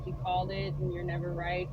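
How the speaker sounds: normal talking voice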